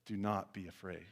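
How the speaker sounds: low, soft reassurance; hushed, steady comfort; Jesus reassuring. A narrator's reading. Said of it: "Jesus spoke to them".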